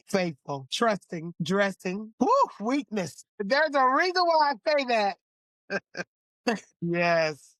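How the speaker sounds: ordinary delivery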